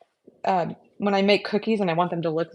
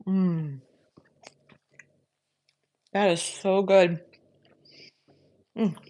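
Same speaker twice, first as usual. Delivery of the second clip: savoring delicious food; slower than usual. Real, not acted. Tasting her fresh cookie.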